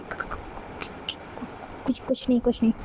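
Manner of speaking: faint breathless stutter trailing off; nervous stammer. A narrator's reading. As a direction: stumble over the words, caught out. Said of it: "घबराते हुए". (घबराकर हकलाते हुए)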